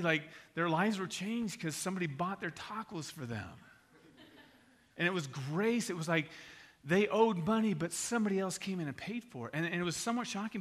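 ordinary talk

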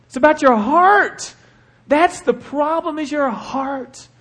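slow, high and loud; emphatic declaration. Real, not acted. Pastor declaring God's words about the heart. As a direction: press each word.

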